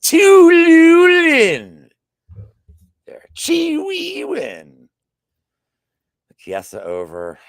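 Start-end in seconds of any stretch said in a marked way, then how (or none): 0.0-1.9 very high falsetto, drawn out very slowly; fancy pinkies-up voice. Pretend. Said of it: "Pinkies up". 3.4-4.9 very high falsetto, drawn out very slowly; fancy pinkies-up voice. Pretend. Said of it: "Pinkies up".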